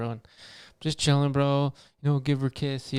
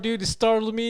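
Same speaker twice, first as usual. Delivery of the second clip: ordinary talk, nothing marked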